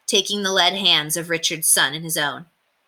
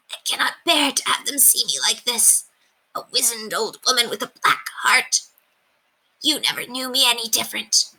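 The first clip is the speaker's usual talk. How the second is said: a lightly voiced old woman, higher and sorrowful; old Calendar hiding her face in shame; ashamed old woman; 6 semitones higher than usual. A narrator's reading. Calendar to the knight she loved, lightly voiced ashamed old woman.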